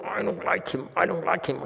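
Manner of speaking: grumbling. Retold or imitated.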